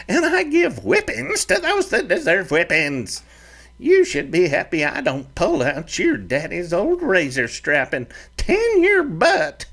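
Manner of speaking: put-on high, fiery old ranch mother; stern mother's threat, pitched high; scolding mother. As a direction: scold your grown boy like a fiery mama. Mother scolds whining son.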